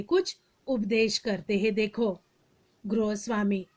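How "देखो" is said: ordinary delivery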